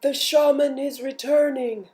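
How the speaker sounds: high, loud announcing call; runner calling out. Acted. Runner calls to the camp, loud call.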